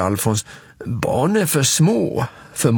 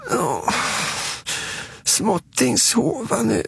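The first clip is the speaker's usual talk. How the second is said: put-on high, soft toddler voice; Småtting; 12 semitones higher, 4 dB quieter than usual. Småtting wanting to stop the scary tale, small child. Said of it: "säger Småtting".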